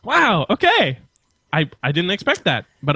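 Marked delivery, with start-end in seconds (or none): 0.0-1.0 high, surprised wow. Real, not acted. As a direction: sound genuinely taken aback. Surprised reaction.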